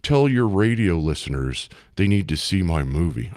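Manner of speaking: low, slightly soft celebrity impression. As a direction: imitate him, deep and commanding. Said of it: "He's like".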